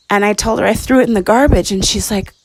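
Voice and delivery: ordinary delivery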